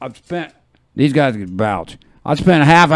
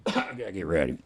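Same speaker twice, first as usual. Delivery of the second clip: ordinary talk, nothing marked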